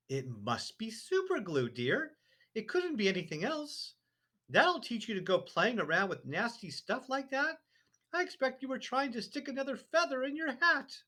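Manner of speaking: high, prim wifely voice; a fussy wife brushing him off; wife's voice. A narrator's reading. Wife dismissing husband's hat trouble, high and prim. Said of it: "Missus Wormwood said to him".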